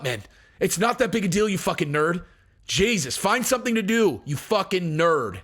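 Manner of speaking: ordinary talk, nothing marked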